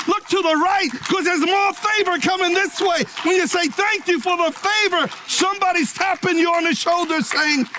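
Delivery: high, ringing, driving preacher cadence; building sermon climax; full-force preaching. Real, not acted. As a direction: lift the whole church with it.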